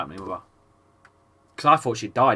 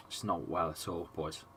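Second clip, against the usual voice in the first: quietly upset; 6 semitones lower, 11 dB quieter than usual. Real, not acted. Worried about sick daughter.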